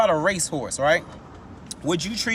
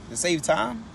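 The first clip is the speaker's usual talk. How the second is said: ordinary talk, nothing marked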